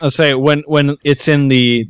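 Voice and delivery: ordinary delivery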